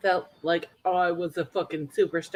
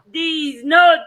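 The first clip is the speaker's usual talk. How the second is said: loud high holler; raucous, high-pitched shout; excited catchphrase; 7 semitones higher, 7 dB louder than usual. Retold or imitated. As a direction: belt it out, giddy and excited. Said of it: "I love when you're excited".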